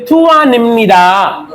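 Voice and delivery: थुआ निमनिदा drawn out slowly, n carried into निमनिदा; slow pronunciation demo. Shown as a demonstration. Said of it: "तो क्या बोलेंगे?". (थुआ निमनिदा धीरे जोड़कर बोलें)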